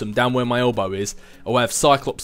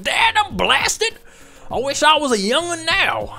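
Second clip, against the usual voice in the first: put-on very high, creaky old-timer voice; exaggerated high-pitched lament of age; cranky older self; 3 dB louder, slower than usual. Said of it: "I don't know why my voice would be like that".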